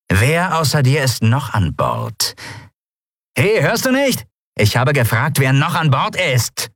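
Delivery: drawn-out demand, rising to impatience; menacing pirate interrogator. Acted. Pirate demanding who else is aboard, menacing and impatient. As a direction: question him slowly, then snap impatiently.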